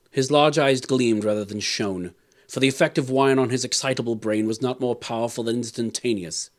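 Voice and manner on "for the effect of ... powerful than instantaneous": plain reading voice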